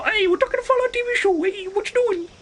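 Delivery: very high squeaky mock-Italian voice; Italian accent. Explaining the topic to raiders, in a very high Italian accent. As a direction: shoot way up into a cartoonish Italian accent. Breaks into the Italian bit again.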